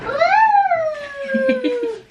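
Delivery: a burst of high laughter. Real, not acted. Mother laughing high at her toddler's antics.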